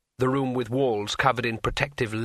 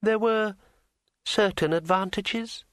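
smooth dryad voice; 9 semitones higher than usual. A narrator's reading. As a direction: glide through it smoothly. Dryad speaks.

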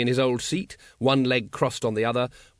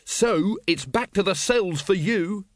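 high, slow, savouring threat; triumphant villain, pitched up; gloating; 7 semitones higher, slower than usual. Acted. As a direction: savour sending him to the cells. Wonse sentences the patrician gloatingly.

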